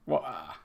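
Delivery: "water" with no T sounded; mock-Cockney "water", gap for the T; dropped T. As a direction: leave out the T in "water". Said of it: "not pronouncing T's".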